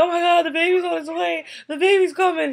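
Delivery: very high, loud mock excitement; ridiculously excited husband. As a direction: imitate an overexcited dad-to-be announcing to everyone. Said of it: "all freaking excited and ridiculous".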